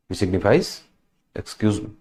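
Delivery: ordinary talk, nothing marked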